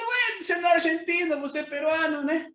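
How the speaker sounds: high, quick teasing disbelief; teasing surprise. Retold or imitated. Preacher teasing his friend about his Argentine accent, high and fast. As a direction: tease a friend who came back with an accent. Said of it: "Eu falei".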